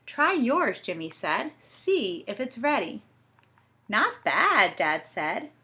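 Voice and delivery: lively storybook reading with voices; reading to children. A narrator's reading. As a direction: read it like a bedtime story.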